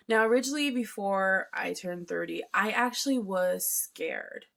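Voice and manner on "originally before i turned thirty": usual speaking voice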